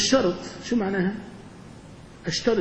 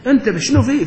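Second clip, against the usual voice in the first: startled reply; 6 semitones higher, 8 dB louder than usual. Acted. Acted reply to being called.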